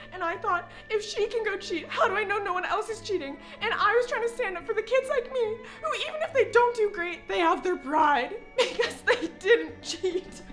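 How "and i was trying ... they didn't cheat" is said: hammed-up crying while talking; tearful. Student standing up for honest kids, crying. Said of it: "I promise I don't usually cry".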